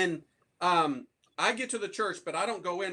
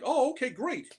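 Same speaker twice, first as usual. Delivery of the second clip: pleased priest; 7 semitones higher than usual. Retold or imitated. Chaplain pleased about confession.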